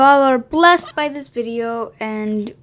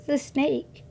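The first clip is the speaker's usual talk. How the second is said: much softer, barely lifting the voice; hushed and ashamed; 9 dB quieter than usual. Acted. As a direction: murmur it with head bowed in shame. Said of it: "not daring to lift her face".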